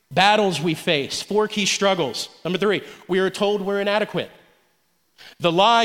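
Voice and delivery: normal talking voice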